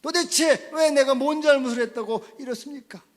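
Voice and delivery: loud, high accusing lament; acted anger at God. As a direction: demand an answer from God in outrage. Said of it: "분노한다는 거예요".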